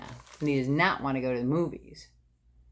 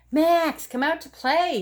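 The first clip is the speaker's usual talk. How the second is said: high, louder calling for a friend; hollering; 10 semitones higher, 4 dB louder than usual. Acted. Scene: Froggy hollers for Max to come play, high and loud. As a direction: holler across the yard. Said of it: "he hollered".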